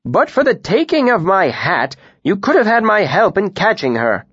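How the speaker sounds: a cheerful, lively tinker. A narrator's reading.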